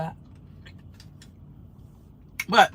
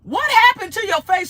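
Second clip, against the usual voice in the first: high, swooping mimicry of a troll; hammed-up rude heckle, pitched way up; mock outraged questioning; 12 semitones higher, 4 dB louder than usual. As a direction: imitate an affronted heckler. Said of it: "That's exact words".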